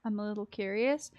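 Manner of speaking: usual speaking voice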